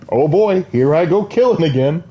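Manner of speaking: hammed-up glee; mock eagerness. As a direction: chirp it like a gleeful killer, in jest. Acts out cheerful reluctance to kill.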